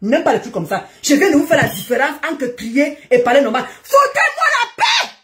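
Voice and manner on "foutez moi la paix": high-pitched, drawn-out scream; cri de démonstration. Shown as a demonstration. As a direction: demonstrate a real yell, very high. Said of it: "C'est ça que j'appelle crier". (hurler d'une voix aiguë)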